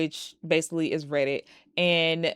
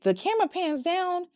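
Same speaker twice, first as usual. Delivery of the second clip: ordinary talk, nothing marked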